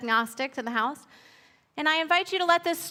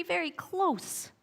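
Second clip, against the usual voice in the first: tiny ant voice; 5 semitones higher, 5 dB quieter, slower than usual. Acted. The ant speaks.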